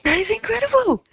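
high, thrilled squeal of disbelief; shrieking joy. Real, not acted. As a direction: let the joy burst out. Sister hears of the win.

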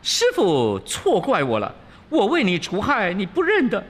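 high-pitched aggrieved Monkey King voice; Wukong protesting. A narrator's reading. Wukong tells Master he was wronged, high aggrieved protest. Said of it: "悟空道".

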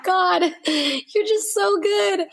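high, slow, crying voice; tearful. Retold or imitated. [name] overwhelmed by God's kindness, crying out slowly. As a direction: let it break through the tears. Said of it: "I just started bawling, crying".

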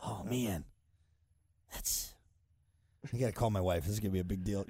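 low, hushed, stunned juror; quietly realizing the weight of it. Retold or imitated. Juror stunned, calling wife.

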